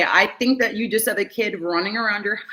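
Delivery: ordinary talk, nothing marked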